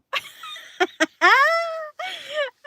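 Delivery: a big shrieking laugh that breaks out; real loud laughing fit; loud burst of laughter. Real, not acted. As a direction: crack up loudly at the joke. Reacting to a funny chat question.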